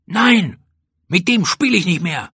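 put-on high-pitched, cross child's voice; indignant refusal. A narrator's reading. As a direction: refuse hotly, still sore from the fight. Sulking child refuses friend.

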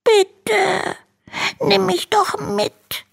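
very high, slow pleading dragon voice; begging little dragon. Acted. Little dragon begs Hanno to take him to school. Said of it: "bettelt der kleine Drache".

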